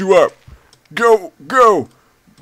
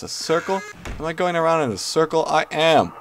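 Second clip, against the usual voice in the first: usual speaking voice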